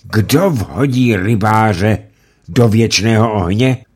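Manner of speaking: loud, very slow defiant cry. Acted. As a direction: hurl the question out. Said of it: "A Ulenšpígl řekl".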